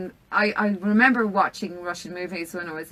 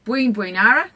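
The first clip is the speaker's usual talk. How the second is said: army command; 3 semitones higher, 6 dB louder than usual. Shown as a demonstration. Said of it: "Watch this".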